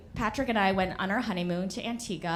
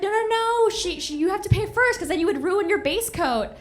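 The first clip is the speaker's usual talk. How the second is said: frantic explaining; 9 semitones higher, 3 dB louder than usual. Retold or imitated. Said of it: "I'm trying to explain".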